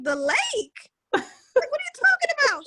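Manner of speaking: can't believe what she hears; shocked disbelief. Retold or imitated. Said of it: "It's like".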